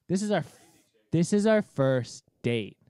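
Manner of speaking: loud, slow, each word leaned on; retold outrage, dragged out for emphasis; appalled disbelief. Man on a first date hearing about the doorstep, loudly appalled. Retold shock at date's confession.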